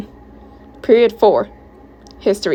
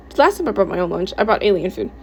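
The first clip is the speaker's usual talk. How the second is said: ordinary delivery, nothing marked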